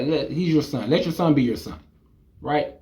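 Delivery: ordinary delivery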